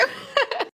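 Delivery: breathy giggle. Real, not acted. Ends story with laugh.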